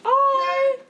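very high, loud held note. Pretend. Suspect taking a turn in the rhyme game, high voice. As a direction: squeal it out long and high.

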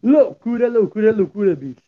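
high, excited TV host catchphrase; Luciano Huck impression. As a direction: shout it like a Sunday TV host. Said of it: "faz o Luciano Huck".

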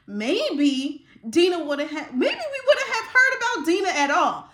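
loud, high, fired-up preaching; screeching, excited voice; getting excited. Real, not acted. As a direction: let the passion boil over into a shout. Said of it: "I'm getting excited".

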